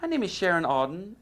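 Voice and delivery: imitated female caller, pitched high; Sharon Auden. Manager introducing herself by phone, imitated female voice. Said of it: "woken up by this female voice".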